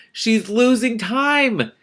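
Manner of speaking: high, slow, drawn-out complaint; exasperated exclaim. Real, not acted. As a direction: wail it at the plot. Frustrated reaction to plot.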